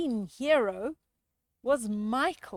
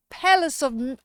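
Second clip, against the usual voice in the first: usual speaking voice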